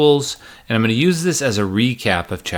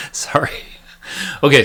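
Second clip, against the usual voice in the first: ordinary delivery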